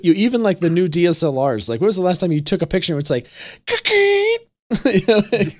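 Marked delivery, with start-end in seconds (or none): 3.7-5.6 laughing. Real, not acted. Laughing through the question.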